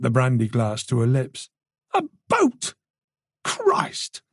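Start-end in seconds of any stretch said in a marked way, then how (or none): 1.9-4.2 high, breaking into an unvoiced cry; appalled cry. Tess learning the killer fled by boat, breaking cry. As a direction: voice cracking in horror.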